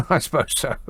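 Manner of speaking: real chuckle through the words; laughing concession. Real, not acted. Radio host conceding [name] sang in English accent, laughing.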